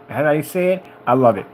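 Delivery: ordinary delivery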